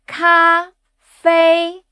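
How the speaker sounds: "Cafe," in two separate high, level syllables; evenly pitched high "Cafe,"; first tone. Shown as a demonstration. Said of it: "words with the first tone".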